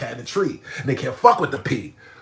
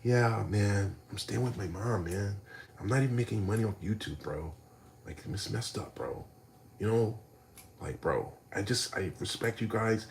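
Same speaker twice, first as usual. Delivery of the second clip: quiet; 4 semitones lower, 8 dB quieter than usual. Retold or imitated. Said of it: "be like this".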